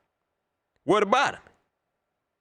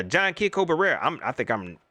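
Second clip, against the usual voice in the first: ordinary delivery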